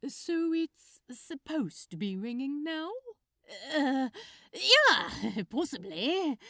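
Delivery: loud, high, giggly and flustered; a young man laughing off his nerves; jittery Crowley, talking too fast; nervous Crowley. Acted.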